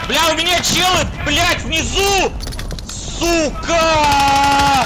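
high loud yell, enemies below; alarmed yell. Real, not acted. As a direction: shout in panic, pitch way up.

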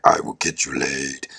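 extremely deep, comic bass; low Persian giant voice. Acted. Persian dude tempting the hunchback, exaggerated low voice. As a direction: drop way down for a giant's offer. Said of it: "and the Persian dude's like".

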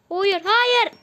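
loud, high cry of no; yelling no as the character fails; dismayed shout. Real, not acted. Gamer watching his character fail, shouting no in dismay. Game character about to die.

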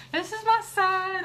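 high, slow and proud. Real, not acted. Mother introducing her son to viewers, high and proud. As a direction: present him with a beaming voice.

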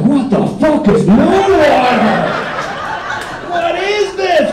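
loud, high, drawn-out disbelief; baffled outburst. Acted. Partner hearing of moon water, baffled outburst. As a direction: stretch it out in exasperated bafflement.

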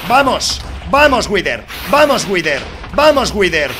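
shout, urgent cheering on; gritando ánimos. Real, not acted. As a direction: yell at the boss to come on. Alentando al Wither en batalla. (gritos de ánimo en plena batalla)

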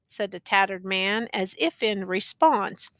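ordinary delivery, nothing marked

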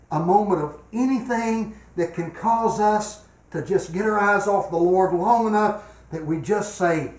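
high, loud, urgent pulpit warning; preaching at full force. Acted. Preacher warning the church that anyone can fall, preaching at full force. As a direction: hammer the warning home to everyone.

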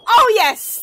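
high, loud yes of triumph; excited cheer. Real, not acted. Player cheering a lucky shot, loud excited cheer. A sudden success.

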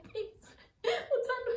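high, squeaky retold sob, half laughing; mock crying. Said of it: "and I cried".

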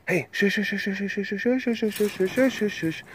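high, soft string of shushes; rapid hushing. Real, not acted. Calming the one being crazy, soft hushing. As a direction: hush them softly, up high.

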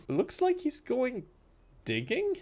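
high, soft and slow wondering; a baffled guard muttering; puzzled guard voice. Retold or imitated.

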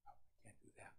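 whisper. Retold or imitated. Mock excuse voiced.

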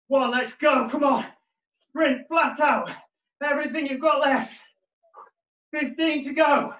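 high, loud coach yelling; shouting encouragement. Real, not acted. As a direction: fire them up for the last sprint. Final sprint, coach yelling encouragement.

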